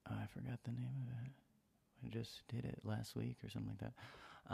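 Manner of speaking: half to yourself, trying to recall the episode; muttered aside. Real, not acted. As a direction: drop to a whisper while you search your memory. Aside while forgetting episode name.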